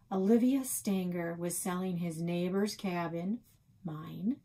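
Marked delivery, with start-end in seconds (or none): none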